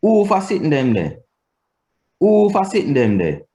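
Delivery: WHO FOR SITTING THEM THERE starting high on WHO, then falling. Shown as a demonstration. Teacher showing pitch on question words, high-start Patois intonation. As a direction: pitch WHO up high and let the rest drop. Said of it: "the rise in pitch affected".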